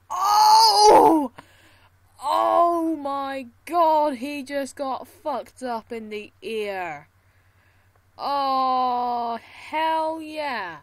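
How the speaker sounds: loud gleeful shouting; excited yelling. Real, not acted. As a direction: roar with delight. Spectacular crash, celebrating.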